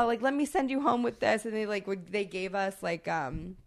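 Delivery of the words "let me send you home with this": light high friendly offer; eager waiter. Retold or imitated. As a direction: pitch it up like a generous waiter.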